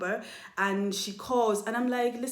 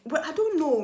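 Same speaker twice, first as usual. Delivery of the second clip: sarcastic; 7 semitones higher, 4 dB louder than usual. Retold or imitated. Sarcastic reply to accuser.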